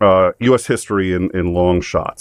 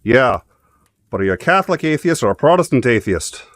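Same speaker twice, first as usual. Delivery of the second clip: Irish guard; 5 semitones higher than usual. Retold or imitated. Guard punchline.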